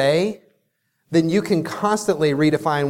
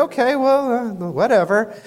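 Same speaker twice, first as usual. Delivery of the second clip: dismissive "whatever"; 6 semitones higher than usual. Retold or imitated. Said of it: "She said".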